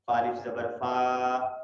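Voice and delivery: slow فا الف زبر فا, final فا held long; slow drawn-out فا; long drawn vowel. Shown as a demonstration. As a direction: stretch the فا slowly. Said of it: "وہ لمبی ہونی چاہیے".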